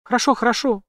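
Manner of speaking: high frantic giving in; panicked shout. A narrator's reading. Jane giving in to the armed villain, high frantic cry. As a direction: cry out in panic to stop him. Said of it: "закричала она".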